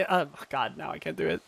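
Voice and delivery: ordinary talk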